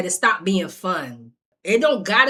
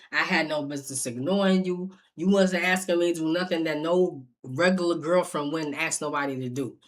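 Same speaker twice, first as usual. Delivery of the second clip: softer, low retold apology; 6 semitones lower, 7 dB quieter, faster than usual. Boyfriend apologizing to her, softer and lower. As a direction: quietly own up to everything. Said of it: "He was like".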